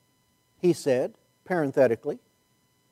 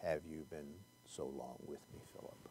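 a low, soft, hushed voice of Jesus; lightly voiced, hushed and far-sounding; 11 semitones lower, 28 dB quieter than usual. Preacher imagining how Jesus sounded to Philip, hushed. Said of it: "I wonder what the voice sounded like".

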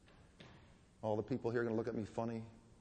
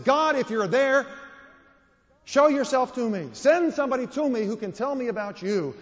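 crying out to God; 13 semitones higher, 14 dB louder than usual. Retold or imitated. Preacher voicing a broken man crying out to God, loud and high. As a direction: call out to God in despair. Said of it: "I cried out and said".

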